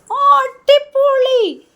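high, loud, slow, stretched-out cheer; mother cheering her toddler's drawing, drawn out, high and loud; delighted praise. Acted. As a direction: gush over the toddler's drawing.

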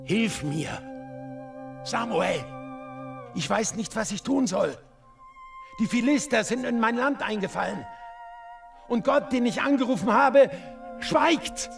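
high-pitched, desperate begging, rising to a cry; desperate plea. Acted. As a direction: beg for help at your wits' end. Saul begs the spirit for help. (verzweifelt flehend)

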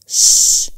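unvoiced "shh" to quieten someone; a firm, whispery hush; hushing shush. A narrator's reading. Karim quiets Ashq on the phone, shushing her.

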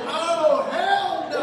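loud, high mock-outraged outburst; big retold yell of refusal. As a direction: cry out in hammed-up indignation.

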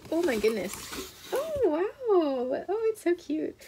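high-pitched gushing over something cute; real delighted awe. Real, not acted. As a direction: react with sudden delight, pitch way up. Delighted reaction on opening the box.

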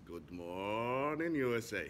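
slow, drawn-out morning-show greeting; American radio DJ. Shown as a demonstration. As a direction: stretch it out like a US radio host. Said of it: "imitate one of these, uh, channels, radio channels in U S".

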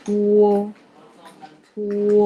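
clear, sustained model of "อัว"; demonstrating pinyin sound. Shown as a demonstration. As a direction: hold "อัว" long on a level pitch. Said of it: "ตัวต่อมาค่ะ".

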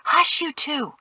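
urgent, reproving hiss. A narrator's reading. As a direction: shush them in a tense hiss. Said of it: "Mari hissed".

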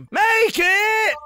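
ordinary talk, nothing marked

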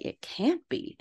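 high, soft disbelief. Retold or imitated. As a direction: jump up high in hushed disbelief. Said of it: "he's like".

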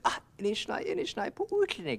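high, loud keening wail; pitiful wailing. Acted. Blind Coyote wails for help, high keening wail. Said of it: "screaming around like that".

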